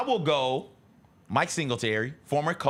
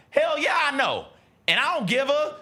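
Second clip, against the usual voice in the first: much higher and louder, defiant; mock fired-up yell; 12 semitones higher, 3 dB louder than usual. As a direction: yell it like a pumped-up player. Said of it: "asked him about it".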